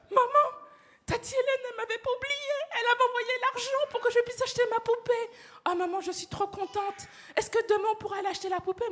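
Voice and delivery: put-on high, breathless child's joy; overjoyed little girl. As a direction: gush the news to mum. Liliane overjoyed telling mum.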